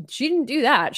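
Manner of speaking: ordinary talk, nothing marked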